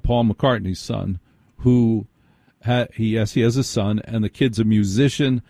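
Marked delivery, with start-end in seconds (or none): none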